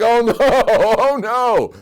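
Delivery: loud, high, drawn-out mock wail; hammed-up horror, stretching each word slowly; panicked dismay. Pharaoh hears his treasurer is crying hysterically, mock panicked dismay.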